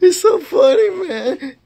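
high, loud words through tears of laughter; real laughing tears. Real, not acted. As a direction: gasp it out crying laughing. Laughing hard at movie.

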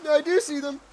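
high, loud confession; high-strung admission. Pretend. Nervously admits seeing shadow people.

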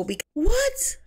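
high startled what; shocked. Real, not acted. Viewer reacting to Bobby's breakup, shocked high exclamation. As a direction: cry out in disbelief.

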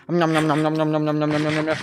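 playful mock chewing sounds; munching noises. Player eating food in the game, playful munching noises. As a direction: nom nom it like a cartoon eater.

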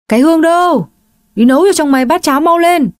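high, loud, fast order; mother yelling for daughter, high and loud; bossy yelling. Acted. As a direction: bark orders across the house.

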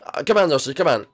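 ordinary delivery

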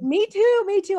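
high, loud eager agreement; excited. Retold or imitated. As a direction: blurt it out thrilled. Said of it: "I was like".

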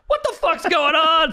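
dad barking for answers; startled dad. Retold or imitated. Dad seeing son covered in paint, alarmed. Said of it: "And he's like".

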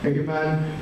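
normal talking voice

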